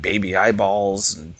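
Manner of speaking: usual speaking voice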